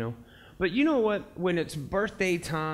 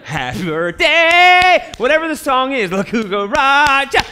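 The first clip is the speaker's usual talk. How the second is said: high, very loud sung-out yell; hammed-up gleeful shouting; obsessed birthday fan; 11 semitones higher, 13 dB louder, slower than usual. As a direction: belt it out like an overexcited party guest. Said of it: "She's obsessed with birthdays".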